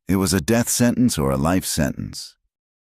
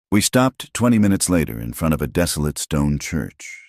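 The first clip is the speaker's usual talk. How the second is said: plain reading voice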